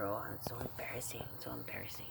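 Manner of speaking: voiceless mock whisper, barely audible; imitating a whisper. Grandma whispering to her friend Pat, a mocking imitated whisper. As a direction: breathe it out like a secret to a friend. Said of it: "she's whispering to her".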